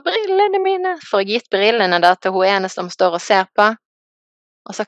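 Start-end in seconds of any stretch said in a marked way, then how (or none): 0.1-0.9 high, sudden cry of alarm; panicked high yelp; mock cry for glasses. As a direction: squeal out for the glasses. Crying out for her glasses.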